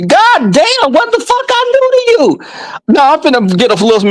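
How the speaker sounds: very high-pitched mock-exasperated squeal; exasperated outburst. Host reacts to lover's complaint, exasperated squeal. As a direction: cry out in comic disbelief.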